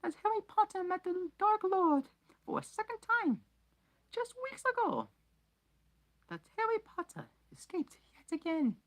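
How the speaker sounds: very high, loud elf voice; high pleading elf voice; squeaky house-elf. Acted. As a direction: pipe it up like a nervous elf. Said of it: "he said hoarsely".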